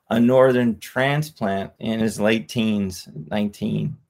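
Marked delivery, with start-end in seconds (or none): none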